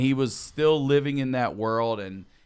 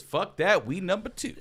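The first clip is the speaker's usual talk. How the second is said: retold backing down; 8 semitones higher than usual. Heckler backs down before Bo Jackson.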